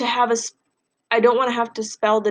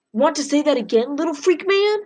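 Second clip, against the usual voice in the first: higher, mocking threat; menacing bully; 6 semitones higher than usual. Bully blocking Freak's path, threatening him in a high mocking voice. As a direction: squeak the insult back at him.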